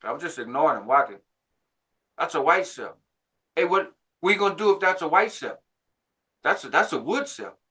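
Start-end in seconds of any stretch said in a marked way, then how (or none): 2.2-7.6 higher-pitched imitated pestering; nagging. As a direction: needle him insistently, mimicking hecklers. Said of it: "they're nagging as I'm walking".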